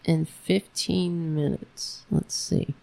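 softly whispered aside; quiet whisper while working it out; whispered to self. Real, not acted. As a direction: breathe it out, checking. Muttering while checking time.

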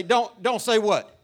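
firm, loud scolding of a son; stern father. Retold or imitated. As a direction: lay down the law to a boy. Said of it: "I say".

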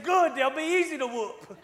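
loud, high, laughing through it; laughing mock threat. Hunter joking about whooping the kids, laughing loudly. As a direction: crack up while playing tough. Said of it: "I said".

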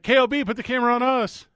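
loud call across the stadium. Retold or imitated. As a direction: shout for the camera crew. Calling out to cameraman.

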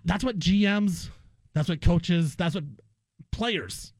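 ordinary talk

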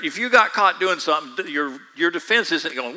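ordinary talk, nothing marked

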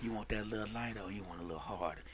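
low, soft, fast seductive murmur; smooth masseur voice. Retold or imitated. Masseur sweet-talking the client on the table, low and soft. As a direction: purr it like a slick masseur working a client.

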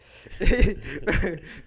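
loud embarrassed laugh at himself; sheepish laugh. Real, not acted. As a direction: laugh off getting carried away. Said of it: "I got a little excited".

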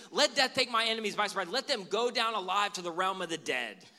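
fast and loud, spitting the curse out; rushed fiery prayer. Pretend. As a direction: rattle it off in hot anger.

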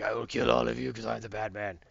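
low mock-menacing hero voice; Batman. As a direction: threaten in a put-on vigilante voice. Batman threatening his captors.

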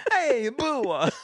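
high squeaky boo through laughter; laughing mock Italian boo. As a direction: giggle out a silly boo. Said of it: "mocks the scary Italian voice".

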